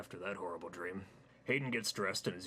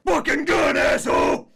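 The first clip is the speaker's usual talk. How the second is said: very high, very loud bellowing; loud angry yell; 20 dB louder than usual. Acted. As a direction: scream at him across the yard. Fat man threatens with gun.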